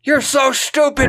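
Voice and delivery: high mock scold. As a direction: jeer at Phil in a shrill mock rage. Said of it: "Susan scolding Phil".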